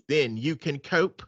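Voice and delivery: low, slow mock British man; man's British accent. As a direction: read it like a smug English gentleman. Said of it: "man and a woman accent".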